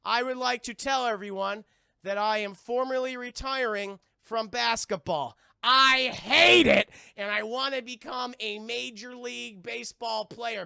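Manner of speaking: slow, loud formal speech, bursting on I hate it; mock press statement. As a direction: announce retirement pompously. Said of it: "He's like".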